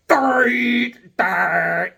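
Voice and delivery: high, loud, garbled muted words; muffled shout into a dead mic; mock muffled mouthing. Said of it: "I'm like".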